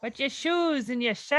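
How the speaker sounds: hammed-up Jersey heckle; Jersey accent. Heckling someone's shoes and shirt, mock Jersey accent. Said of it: "That's fucking Jersey".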